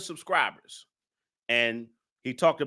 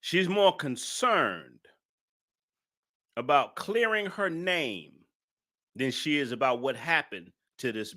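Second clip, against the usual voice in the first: repeating the point deliberately; slow repeat. Real, not acted. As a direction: spell "She's more concerned about clearing her name" out slowly. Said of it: "I'm gonna say this again".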